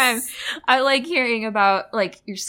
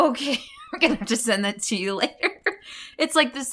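normal talking voice

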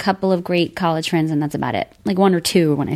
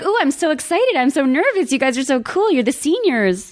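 higher-pitched gushing admiration; excited and nervous; 5 semitones higher than usual. Retold or imitated. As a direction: imitate your starstruck, nervous younger self. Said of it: "I was totally excited and intimidated".

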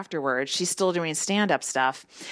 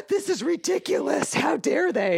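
high, huffy mock outrage; hammed-up indignation, much higher; mocking outraged comic; 8 semitones higher than usual. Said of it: "comics are like".

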